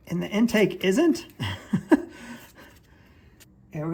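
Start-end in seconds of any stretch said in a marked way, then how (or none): 1.3-2.3 low, breathy chuckle. Real, not acted. Mechanic finding the intake valve out of place, chuckling.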